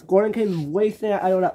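ordinary delivery, nothing marked

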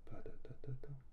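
whispered rhythm syllables in time. Shown as a demonstration. Teacher whispering a rhythm to the class, hushed. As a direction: breathe the beat out, no voice. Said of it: "we hear them".

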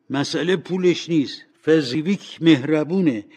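rising, heartfelt praise; impassioned defence. Acted. Old Scrooge defends his kind master.

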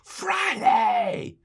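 very high, drawn-out preacher's cry; top-of-the-lungs yell. Retold or imitated. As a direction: imitate a preacher yelling at full pitch. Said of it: "he just yelled at the top of his lungs".

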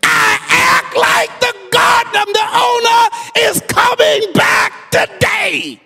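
high, loud climactic preaching; shouted, rising sermon climax; full-force preaching. Acted. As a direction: build the sermon to a roaring peak.

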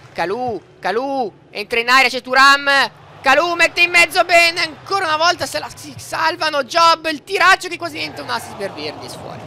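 high, loud match commentary; excited play-by-play. Acted. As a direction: call the action like a telecronista. (telecronaca concitata)

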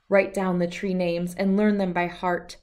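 plain reading voice

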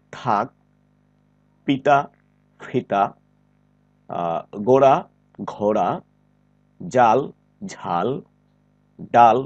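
each word slow and separate, second of each pair aspirated; slow drill with pauses, plain then aspirated; careful minimal-pair drill. Shown as a demonstration. Teacher reading a minimal-pair list for students to repeat. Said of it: "দেখো, আমি এইভাবে উচ্চারণ করছি".

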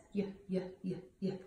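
"Y" as a short, soft yuh; letter sound demo. Shown as a demonstration. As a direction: sound out "Y" low-key, four quick times. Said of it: "Yancy Yak says".

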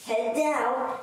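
ordinary talk, nothing marked

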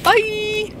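playful high sign-off to viewers; cheery high bye. Real, not acted. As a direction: chirp the goodbye up high. Signing off to viewers.

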